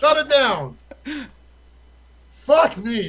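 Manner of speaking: high, loud laughing gloat. Real, not acted. Laughing gloat after win.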